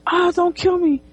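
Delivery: high-pitched mock panic at normal volume; mock scream. Man waking from a nightmare, mock scream. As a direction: imitate a frightened cry without yelling. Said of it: "he screams and he's like".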